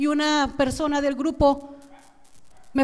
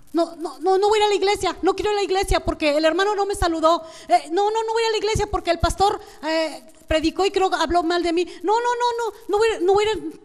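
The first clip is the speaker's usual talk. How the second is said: higher-pitched, insistent imitation of a complainer; mock stubborn refusal; 4 semitones higher than usual. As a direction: refuse over and over, acting fed up. Acts out a stubborn churchgoer refusing. (negativa terca imitada)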